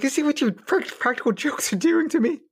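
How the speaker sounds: high-pitched mock-outraged complaint; retold upset. Man to girlfriend after her pranks backfired, upset. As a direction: protest in a high, aggrieved voice. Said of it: "but I was so upset".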